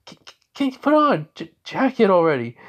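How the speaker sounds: high, stammering, flustered plea; flustered embarrassment. Acted. Girl to the shirtless man, flustered and embarrassed. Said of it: "she realized that her face goes red".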